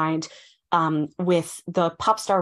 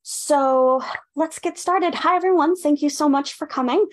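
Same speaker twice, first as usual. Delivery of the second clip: higher, projected welcome to a crowd; greeting the audience; 9 semitones higher than usual. Real, not acted. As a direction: open the event, voice lifted to the room. Opens event, greets the audience.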